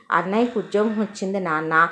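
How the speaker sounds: ordinary talk, nothing marked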